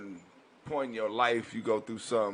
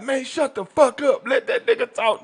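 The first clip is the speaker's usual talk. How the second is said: imitated angry yell; 10 dB louder than usual. Inmate shutting others up so the storyteller can talk, yelled. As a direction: yell over the noise to silence someone. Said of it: "somebody yell like".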